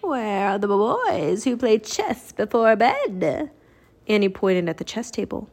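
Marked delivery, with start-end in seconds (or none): 0.0-3.5 slow, drawn-out eerie recital; stretched-out, singsong verse; reciting. A narrator's reading. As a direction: recite it like a mysterious riddle. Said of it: "Annie recited more".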